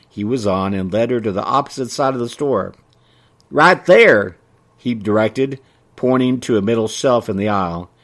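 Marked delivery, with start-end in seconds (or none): 3.5-4.4 high, quick country storekeeper; chatty small-town merchant; folksy shopkeeper voice. Acted. Ray directing.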